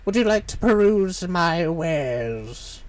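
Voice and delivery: put-on slow, scratchy old shopkeeper; scratchy merchant voice. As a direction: drawl out your wares like a sly merchant. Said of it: "Have a little bit more scratchiness".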